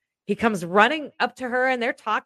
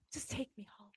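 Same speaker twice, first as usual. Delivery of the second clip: soft breathy whisper, begging; whispered plea; 20 dB quieter than usual. Retold or imitated. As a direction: whisper it like a tired prayer to God. Begging God to take her home.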